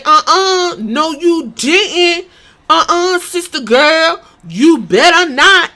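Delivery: high, loud, hammed-up sassy put-on. Mocking a sassy wrestling character, hammed-up and loud. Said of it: "have her roll her neck and say".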